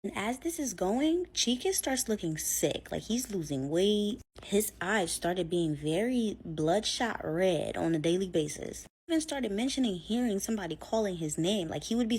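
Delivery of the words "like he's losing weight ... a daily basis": usual speaking voice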